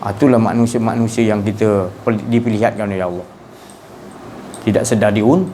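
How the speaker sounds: ordinary talk, nothing marked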